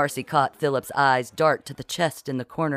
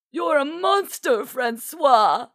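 very high, loud scolding cry; outraged denunciation; 11 semitones higher, 4 dB louder, slower than usual. A narrator's reading. The Duchess wags her finger at Francois, outraged. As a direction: denounce him at full pitch.

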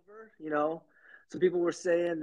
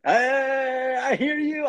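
calling out; 8 semitones higher, 4 dB louder than usual. Real, not acted. Loud greeting call-out.

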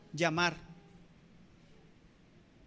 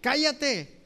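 high, raised scolding command; orden de callar imitada; 5 semitones higher, 3 dB louder, much slower than usual. Retold or imitated. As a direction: hush someone sharply, as an annoyed crowd would. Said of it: "y le decían". (regaño imitado, con voz aguda y alzada)

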